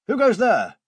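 high, loud challenge called back. Acted. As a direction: shout it out like a wary sentry. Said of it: "he called back".

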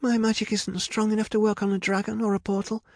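high-pitched, urgent insistence; protesting. A narrator's reading. Rapunzel demands Medea fix the mess, urgently protesting. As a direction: throw the blame back and demand action. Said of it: "protested Rapunzel".

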